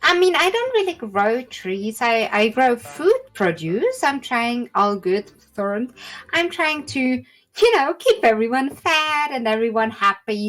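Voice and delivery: chatty halfling woman explaining what she grows; halfling farmer. Acted. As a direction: raise the voice, talk like a cheery farmer.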